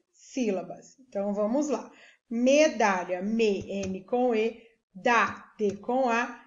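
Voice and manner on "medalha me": ordinary talk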